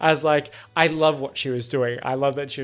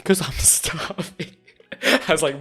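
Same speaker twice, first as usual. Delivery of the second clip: laughing; 2 semitones higher, slower than usual. Retold or imitated.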